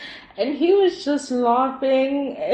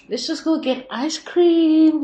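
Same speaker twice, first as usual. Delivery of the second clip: upbeat suggestion; 6 semitones higher, 7 dB louder, slower than usual. Pretend. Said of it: "a partner being like".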